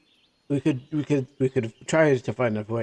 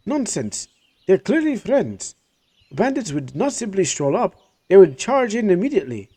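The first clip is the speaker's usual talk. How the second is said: higher, insistent protesting voice; indignant merchant; 7 semitones higher, 4 dB louder than usual. Acted. Jafar defends the strangers to Schneider, higher and insistent. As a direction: brush off the warning with conviction.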